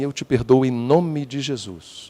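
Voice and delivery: soft, slow, saintly tone; a syrupy show of forgiveness; mock pious forgiveness. Forgiving the old lady who bumped you, soft and slow. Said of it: "e você olha e fala assim".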